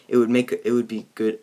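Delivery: usual speaking voice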